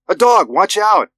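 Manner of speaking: high, loud panicked shout; alarmed warning. A narrator's reading. Wife screams warning at driving husband, high and loud. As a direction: scream it at the driver. Wife warns of dog ahead.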